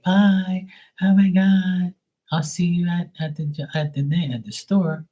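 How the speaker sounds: high giddy voice, slowing and fumbling; drunk girl goodbye. Retold or imitated. Drunk girl leaving the DJ booth, high and fumbling. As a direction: trail off tipsy and lost for words.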